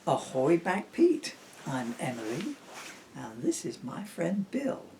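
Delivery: soft storybook read-aloud; soft rhyming bedtime reading; reading to children. Acted. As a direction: lull them into the story gently. Reads rhyming picture book aloud.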